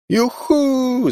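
high, drawn-out whoop; loud whoop. A narrator's reading. Jim whooping on a wild drive, high joyful call. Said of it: "zawołał znowu Jim".

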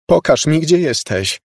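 głośna, wysoka prośba; błagaj, wołając podniesionym głosem; błagalne wołanie. A narrator's reading. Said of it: "zawołała błagalnie". (rozpaczliwie błagalny okrzyk)

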